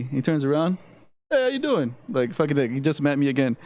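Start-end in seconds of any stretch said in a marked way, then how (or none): 1.3-1.9 pitched-up mock New York Italian hello; imitating a Sopranos-type greeting. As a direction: ham up a friendly Jersey-style hey. Tony greeting, Italian-American.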